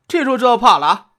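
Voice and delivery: jeering yell, pitch up and loud; angry shout. Acted. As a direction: bark it at them with contempt. Thug jeering at cowed guests.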